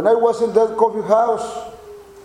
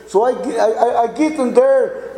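usual speaking voice

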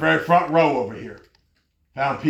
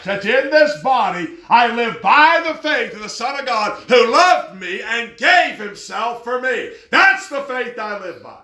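high, loud, ringing scripture preaching; full-force preaching; 6 semitones higher, 3 dB louder than usual. Acted. Preacher proclaiming Galatians to the church at full force. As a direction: preach it out to the back row.

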